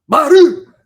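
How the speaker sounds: put-on high, loud toddler shout of "Baru!"; toddler shouting a word. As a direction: yell "Baru!" like a baby running through the kitchen.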